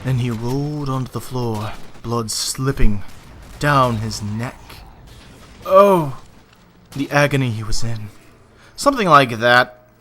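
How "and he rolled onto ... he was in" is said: dramatic narration. Shown as a demonstration. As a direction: read it like a grim thriller. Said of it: "when I'm doing something like this".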